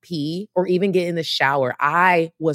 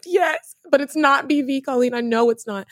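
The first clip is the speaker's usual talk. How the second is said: insistent denial; 8 semitones higher than usual. Retold or imitated. Insisting to friend Colleen.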